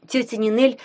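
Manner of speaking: ordinary delivery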